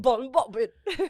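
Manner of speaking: exaggerated British echo; put-on British accent. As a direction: ham up a British accent, amused. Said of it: "not in your actual native accent".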